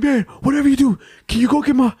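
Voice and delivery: high frantic rush of pleading; panicked yelling. Retold or imitated. Son begging stepdad to bring his wallet, frantic yelling. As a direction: re-enact begging at the top of your range. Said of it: "I'm just yelling".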